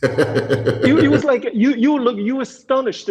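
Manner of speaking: high, laughing disbelief; real laughter breaking into the words. Real, not acted. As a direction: laugh through it, still amazed. Laughing while recalling the astonishing moment.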